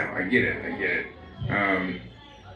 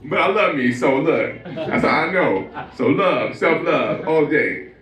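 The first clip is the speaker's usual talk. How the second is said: Jay-Z speech. Acted. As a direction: brag about yourself like a star. Jay-Z self-love speech.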